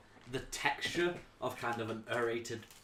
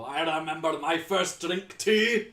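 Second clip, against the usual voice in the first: laughing bartender; 7 semitones higher, 10 dB louder than usual. Acted. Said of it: "You hear the bartender while he's laughing go".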